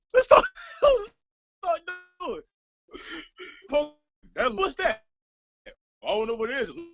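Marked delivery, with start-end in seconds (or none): 0.1-5.0 high, slow, half-crying exclamation; high disbelieving wail; exasperated outburst. Real, not acted.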